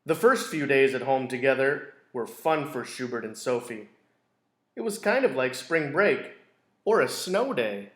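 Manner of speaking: gentle storybook narration; teacher reading a picture book to a class; reading to children. A narrator's reading. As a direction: read it like circle time for kids. Reads picture book aloud.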